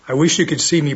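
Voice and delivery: ordinary talk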